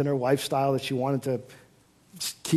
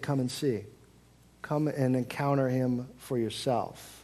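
calm invitation; 3 semitones lower, 7 dB quieter than usual. Acted. Philip's calm simple invitation.

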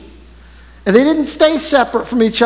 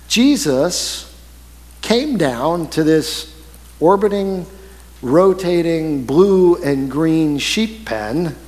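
solemn slow pulpit delivery; hushed sermon climax; 3 semitones lower, much slower than usual. Acted.

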